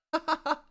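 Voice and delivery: very high squeaky laugh; squeaky high-pitched giggle; squeak. Real, not acted. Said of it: "That was like the four X squeak".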